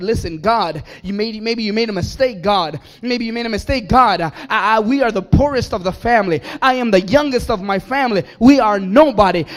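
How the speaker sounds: Gideon's loud complaint. Acted. Gideon protesting loudly to God about being poorest. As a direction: lay out the complaint loud and fast.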